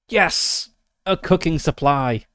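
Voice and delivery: loud, high burst of delight; real elated exclamation; triumphant cheer. Real, not acted. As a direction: celebrate a lucky roll, starting high and easing down. Gets the hoped-for roll.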